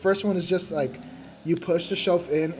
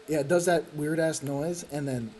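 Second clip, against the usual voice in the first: ordinary talk, nothing marked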